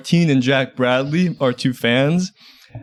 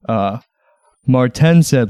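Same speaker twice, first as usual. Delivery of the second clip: usual speaking voice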